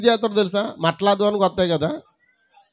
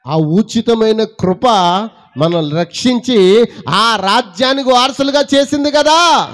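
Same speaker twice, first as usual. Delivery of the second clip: high, loud, ringing preacher's cry; full-force preaching; 6 semitones higher, 5 dB louder than usual. Acted. Preacher lifts his voice loudly to the congregation. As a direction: proclaim it loudly to the church.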